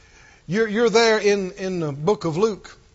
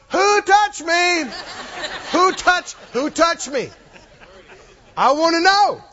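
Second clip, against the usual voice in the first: insistent Jesus; 7 semitones higher than usual. Acted. Jesus demands of his disciples, slow and insistent. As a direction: insist on knowing, slow and firm.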